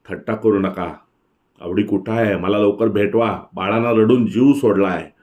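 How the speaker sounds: plain reading voice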